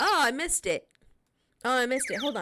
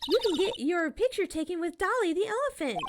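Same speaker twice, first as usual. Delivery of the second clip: put-on higher, bubbly teenage girl voice, teasing; Maya character voice; 9 semitones higher than usual. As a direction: play the cheeky girl sidekick, pitched up. Voicing Maya again.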